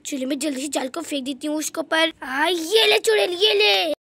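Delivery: ordinary talk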